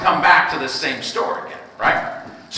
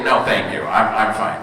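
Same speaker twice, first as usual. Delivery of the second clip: polite refusal; 10 semitones lower, 3 dB quieter than usual. Retold or imitated. Dad politely refusing toddler's snack.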